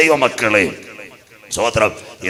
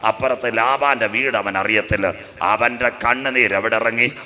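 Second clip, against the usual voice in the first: normal talking voice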